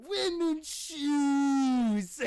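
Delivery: very high, very loud, hugely stretched; hammed-up bellow with a long-held last word; drawn-out shout. Repeating the film line to friend, drawn-out bellow.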